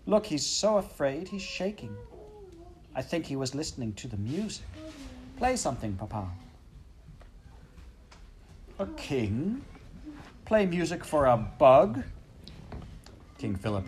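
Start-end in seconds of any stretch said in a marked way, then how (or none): 0.1-6.3 high pleading princess; Princess Pea. A narrator's reading. Princess begs her father. 8.8-12.0 high, huffy royal scoff; indignant king. A narrator's reading.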